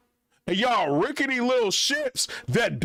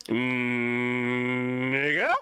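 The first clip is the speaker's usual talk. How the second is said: low, buzzing mock voice of a fly, lower; voicing the fly; 12 semitones lower, much slower than usual. Fly on the mic heckling the vlogger, in a low mock voice. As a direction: growl it out low like an insulting fly. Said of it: "And this fly just like".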